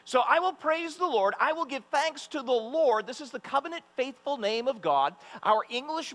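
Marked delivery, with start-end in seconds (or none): 0.0-3.1 high, loud fervent psalm reading; preaching scripture reading. Acted. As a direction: proclaim the psalm with joy. Reads psalm with fervour.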